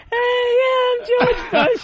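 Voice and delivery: bad impression in a very high voice; George Bush. Comic badly fakes the president on request, absurdly high. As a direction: do a hopeless, squeaky presidential impression. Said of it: "let's see you do George Bush".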